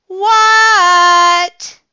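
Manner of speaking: high, loud, long-held "what"; shouted answer. Acted. Froggy yelling back to his mom, shouted answer. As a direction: yell back, stretching the word.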